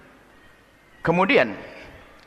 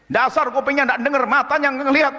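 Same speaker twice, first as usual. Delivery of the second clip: berating mother; 9 semitones higher than usual. Retold or imitated. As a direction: scold the child's ears and eyes.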